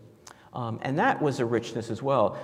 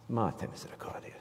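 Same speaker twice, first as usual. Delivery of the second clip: hushed, breathy murmured prayer; whisper; 11 dB quieter, faster than usual. Retold or imitated. Grandmother blessing herself to hush the talk, whispered. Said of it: "she'd bless herself and put her hand up".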